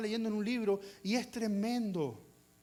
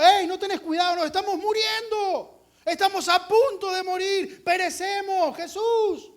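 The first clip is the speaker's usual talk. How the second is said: high, loud frantic wail; frightened disciples shouting to wake Jesus; panicked yelling; 9 semitones higher, 7 dB louder than usual. Retold or imitated. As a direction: cry out as if drowning. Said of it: "Ellos estaban diciendo".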